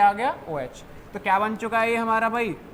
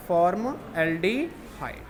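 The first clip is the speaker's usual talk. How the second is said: name drawn out in slow pieces; slow, syllable-by-syllable; 2 semitones lower, much slower than usual. Real, not acted. Teacher gives students the compound's name, slowly broken up. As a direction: say it slowly, part by part.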